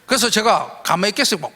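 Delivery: ordinary talk, nothing marked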